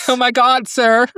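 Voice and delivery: high, loud mock shock; melodramatic nurse. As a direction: cry it out, hammed up. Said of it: "She's so melodramatic".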